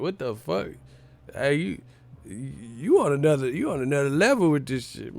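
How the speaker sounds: normal talking voice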